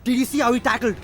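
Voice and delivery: high, indignant complaint without shouting; angry. Acted. Driver complaining to girlfriend about traffic, angry. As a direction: protest the other driver's move. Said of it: "Why are you getting so angry?".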